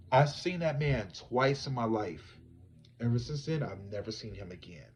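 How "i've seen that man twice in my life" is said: ordinary talk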